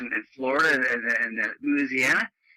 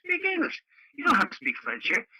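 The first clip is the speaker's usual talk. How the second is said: insistent demand; 12 semitones higher than usual. Retold or imitated. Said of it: "I said".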